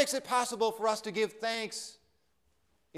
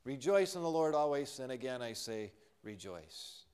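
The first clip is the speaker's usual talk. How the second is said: trite, dismissive; 7 semitones lower, 7 dB quieter than usual. Shown as a demonstration. The pastor voices how doubters hear the verse, dismissively. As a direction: lower and softer, fading to nothing. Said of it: "a trite statement".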